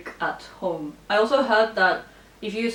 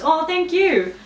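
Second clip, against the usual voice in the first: delighted thanks; 8 semitones higher, 5 dB louder, slower than usual. Real, not acted. Thanking a viewer's kind comment.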